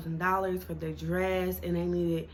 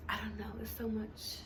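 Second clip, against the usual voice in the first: whispering; 3 semitones higher, 11 dB quieter than usual. Real, not acted. Whispered aside in intro.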